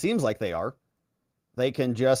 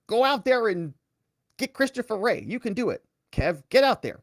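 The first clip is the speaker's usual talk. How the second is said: higher, hammed-up coach firing up his player; mock coach pep talk; 7 semitones higher than usual. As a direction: pump him up like a sideline coach. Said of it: "back to his coach and he's like".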